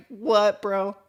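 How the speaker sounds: high-pitched incredulous reply; baffled disbelief. Retold or imitated. Housemate answers an absurd screamed threat, imitated high bafflement. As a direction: act out being utterly thrown.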